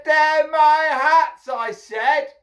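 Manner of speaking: high, loud, drawn-out shout; angry insistence. A narrator's reading. Hatter shaking his fist at monkeys, angry shout. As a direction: insist furiously up at the trees.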